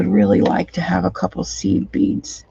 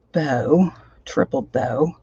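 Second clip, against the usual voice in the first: ordinary talk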